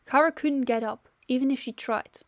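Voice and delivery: ordinary delivery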